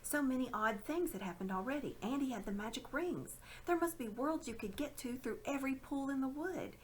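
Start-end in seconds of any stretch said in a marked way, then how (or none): none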